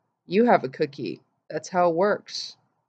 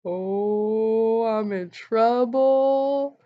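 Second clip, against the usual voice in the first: slow, higher, drawn-out uh-oh; dawning dread; 4 semitones higher, much slower than usual. Retold or imitated. Mom realising sister broke a tooth, slow uh-oh dread. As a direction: stretch it out as panic sinks in.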